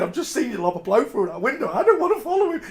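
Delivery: high, laughing mock panic; laughing retelling. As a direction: laugh through the scared memory. Retelling scared plea to drunk mate.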